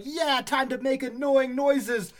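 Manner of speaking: high, drawn-out cicada character; eager cicada. Acted. Last cicada just hatched on a tree, mock-triumphant. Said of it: "and he's like".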